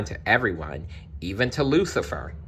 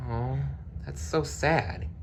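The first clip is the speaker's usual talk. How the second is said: softer, slow and sad; pitying aside; 5 semitones higher, 7 dB quieter than usual. A narrator's reading. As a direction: say it with soft pity.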